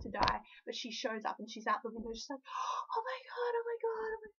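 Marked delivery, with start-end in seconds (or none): 2.4-4.3 high breathless whisper of disbelief; excited whisper. Retold or imitated. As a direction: gasp it out, barely able to speak. Friend sees her inside.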